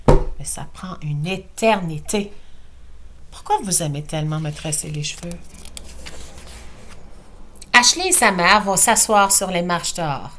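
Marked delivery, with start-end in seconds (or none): 0.1-5.3 whispered, then fast and soft; hushed complaint. Acted. Mother quietly complains about the braiding. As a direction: grumble it under your breath.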